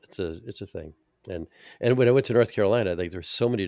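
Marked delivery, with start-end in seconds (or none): none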